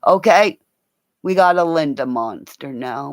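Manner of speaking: ordinary talk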